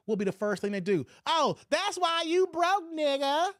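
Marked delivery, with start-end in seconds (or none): 1.3-3.5 high, slow sneering taunt; mock the woman's put-down, pitched up. Vlogger mocking how women insult men, high and drawn out.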